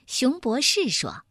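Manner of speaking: ordinary delivery, nothing marked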